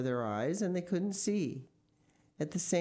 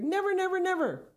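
high, loud, insistent repetition; panicked high pleading; desperate cry; 14 semitones higher, 5 dB louder, faster than usual. A narrator's reading. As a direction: swear it frantically, pitch way up. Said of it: "cried Mister Gregg".

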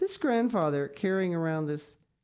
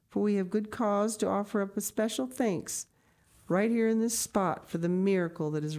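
reassuring lady; 4 semitones higher than usual. Acted. Grandmama promising the grandmother they will return, reassuring. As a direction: brush away her fears warmly.

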